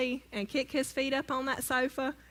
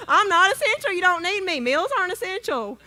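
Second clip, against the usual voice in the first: higher and louder, sassy and playful; mock sarcastic; 7 semitones higher, 5 dB louder than usual. To her husband about his unwashed tees, higher, louder and mock sarcastic. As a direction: throw his words back at him, teasing. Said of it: "I said".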